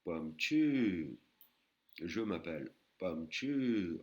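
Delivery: slow Thai demo phrase. Shown as a demonstration. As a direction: model "Pam Chu" with a falling tone. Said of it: "deuxième phrase".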